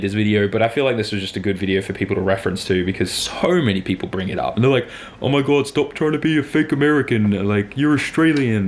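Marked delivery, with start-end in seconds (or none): 5.2-8.7 higher-pitched mock complaint; put-on voice of scolding Australians; upset commenters. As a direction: imitate indignant online critics. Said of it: "from upset Australians".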